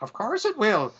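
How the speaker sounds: a hint of high old-woman voice; old lady. Witch reassures the burglar, lightly voiced high old lady. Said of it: "And she's like".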